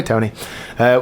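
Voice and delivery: ordinary talk, nothing marked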